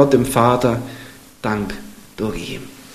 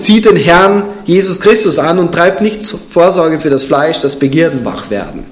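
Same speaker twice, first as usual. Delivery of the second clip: solemn, raised reading of a Bible verse; scripture reading; 4 semitones higher, 3 dB louder than usual. Acted. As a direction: proclaim the verse to the congregation. Reads scripture aloud.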